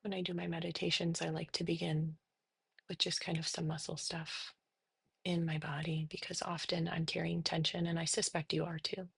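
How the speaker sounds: soft, unhurried meditation voice with long pauses; guided meditation. Real, not acted. Therapist leading listeners through breathing and body noticing, soft meditation. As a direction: guide them gently into settling, softly.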